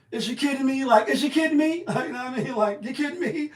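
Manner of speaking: laughing you've got to be kidding; loud amused disbelief; incredulous. Real, not acted. Incredulous reaction to her claim.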